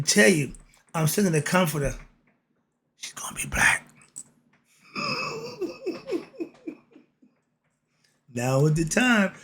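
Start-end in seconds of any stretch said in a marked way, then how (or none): 3.0-3.9 secret whispered punchline. Acted. 4.9-6.4 laugh. Real, not acted. Laughs at own joke.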